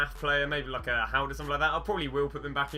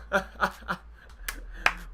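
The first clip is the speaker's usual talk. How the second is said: delighted laugh; 6 semitones higher than usual. Real, not acted. Pack reveal worth a lot.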